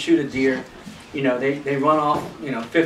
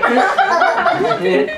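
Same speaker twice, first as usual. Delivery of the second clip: a high laughing "And", louder; 14 semitones higher, 5 dB louder than usual. Real, not acted. Laughing at own story.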